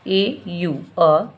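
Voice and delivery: usual speaking voice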